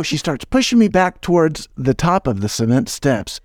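usual speaking voice

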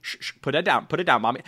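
ordinary talk

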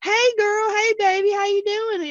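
high-pitched, gushing fake-sweet greeting; fake Southern friendliness. Fake Southerner greeting everybody, high sugary put-on voice. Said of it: "the South, we're all fake".

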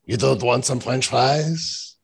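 put-on slow, teasing French accent; mock French accent. French jokes with co-host.

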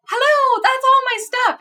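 high, loud mock outcry; indignant protest. Traveler protesting to security officer about abandoned belongings, indignant.

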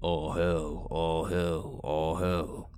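low, garbled, monotone mimicry; inhuman echo. The creature echoes the narrator from the canopy in a deep monotone. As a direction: repeat it back like a thing wearing his voice.

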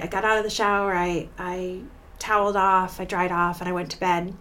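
ordinary talk, nothing marked

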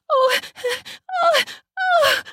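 high breathy cries; a run of short, high "oh"s; crying out. Acted. Wife spanked on repeat, crying out.

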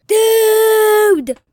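loud excited yell; excited shout. Real, not acted. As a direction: shout it out thrilled. Excited about explosion.

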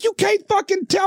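incredulous high-pitched imitation; mock a furious worker's retort; retold outraged yell; vehement screaming. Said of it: "vehemently screaming at me".

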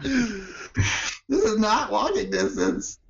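high, drawn-out imitation of a whiny protest; hammed-up slow, high-pitched grievance; mock exhausted complaint. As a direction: wail it slowly, mocking weary walkers. Quoting the worn-out walkers' complaint.

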